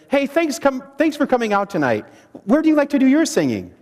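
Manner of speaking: fast, abrupt jump straight into the question; rushed opener. Shown as a demonstration. A leader rushing the question at newcomers, hurried. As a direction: blurt the question out too fast. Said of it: "if I just jump right into things and say".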